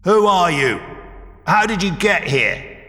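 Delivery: loud, high startled shout at strangers. A narrator's reading. Young man shouting at the intruders, loud and high. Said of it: "the younger man shouted".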